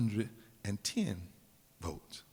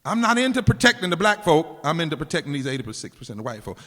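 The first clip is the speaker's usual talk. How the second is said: loud, higher, mocking paraphrase; mock Bush voice; 3 semitones higher, 11 dB louder than usual. Preacher mocking Bush's real meaning to the crowd, loud and scornful. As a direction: blow up what he really meant. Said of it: "but that's what he's saying".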